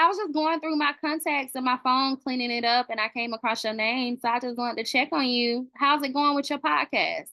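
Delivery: ordinary delivery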